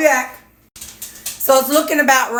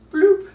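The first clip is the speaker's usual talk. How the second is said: high playful "Bloop"; high cartoon bloop; bloop effect; 9 semitones higher than usual. Real, not acted. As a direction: pop the word like a dropping sound. Sound effect for dropping veggies.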